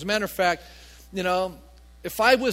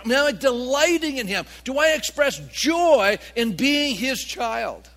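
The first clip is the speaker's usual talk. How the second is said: raised pitch, punched key words; impassioned preaching; 3 semitones higher, 5 dB louder than usual. Real, not acted. Preacher to church on rejoicing in God, impassioned. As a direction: preach it with rising fervor. Preacher exhorts at full force.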